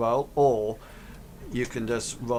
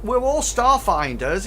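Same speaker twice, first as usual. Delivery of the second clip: raised, higher, a bit louder; Hank voiced; 8 semitones higher, 5 dB louder than usual. GM voicing Hank insisting, higher and louder. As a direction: protest it in a high voice. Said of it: "he says".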